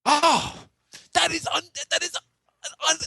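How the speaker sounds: high, slow, sputtering disbelief; mock outrage. Pundit stunned by co-host's answer, sputtering mock outrage. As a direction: choke on the word in hammed-up dismay.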